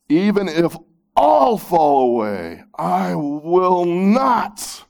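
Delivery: loud-hearted, high, drawn-out declaration; emphatic vow. Acted. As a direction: declare it boldly, dragging out the last words. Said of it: "Peter insisted emphatically".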